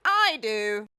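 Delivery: loud, firm and final. A narrator's reading. The Duke firmly refuses the giraffe. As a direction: put your foot down. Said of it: "the Duke said firmly".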